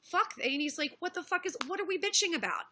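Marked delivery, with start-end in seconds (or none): 0.0-0.3 mock-exasperated curse, pitched high; retold exasperation. Streamer quoting Bering's attitude to viewers, exasperated. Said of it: "that was his attitude". 1.0-2.7 higher-pitched, mildly exasperated challenge; retold exasperation. Streamer retelling Bering's reaction to the debate, exasperated. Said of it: "that was his attitude".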